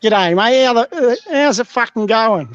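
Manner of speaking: hammed-up Aussie bloke saying g'day; Australian accent. Shown as a demonstration. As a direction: lay the Australian accent on thick. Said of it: "So you gotta say like that".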